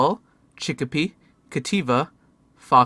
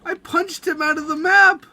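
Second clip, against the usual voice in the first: high, loud panicked exclaim; high loud shocked yelling; shocked excitement; 5 dB louder, slower than usual. Real, not acted. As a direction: blurt it, half laughing. Punched pal out of map.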